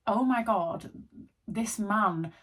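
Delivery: normal talking voice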